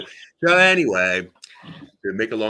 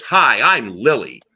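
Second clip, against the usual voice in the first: loud, slow, drawn-out greeting; bold introduction; 3 semitones higher, 10 dB louder, much slower than usual. Retold or imitated. Lily Tomlin introducing herself with hand out, bold. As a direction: announce yourself grandly, stretching each word.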